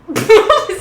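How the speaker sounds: sudden high laugh at herself; burst of laughter. Real, not acted. Streamer bursting out laughing at her own face. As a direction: crack up at your own face on camera.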